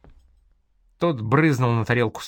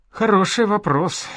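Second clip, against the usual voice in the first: higher, yawny reply; yawning; 10 semitones higher, 3 dB louder than usual. A narrator's reading. Caravan leader answers the scholar's question, yawning. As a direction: answer through a yawn. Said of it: "Караван-баши зевнул".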